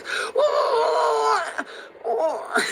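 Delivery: hammed-up shouted whoa of an angry old dad; dad's whoa. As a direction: bellow like an old man startled. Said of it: "That's how he does it".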